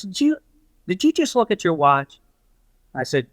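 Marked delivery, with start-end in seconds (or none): 0.0-2.2 retold disbelief, pitched high; mock incredulous question. Dying coach to visitor glancing at his watch, retold incredulity. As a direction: imitate someone catching a friend out, higher voice.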